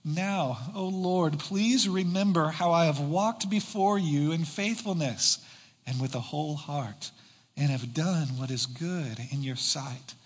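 hushed, solemn reading of the prayer; scripture reading. Acted. Pastor reads Hezekiah's prayer to the congregation, hushed and solemn. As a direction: read the prayer softly, as a plea.

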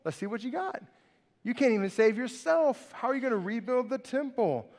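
preacher voicing the mocking crowd at the cross; jeering mockery. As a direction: taunt him like a sneering passerby. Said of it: "the people walking by are mocking him".

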